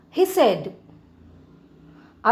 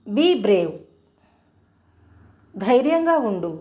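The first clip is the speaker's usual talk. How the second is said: ordinary talk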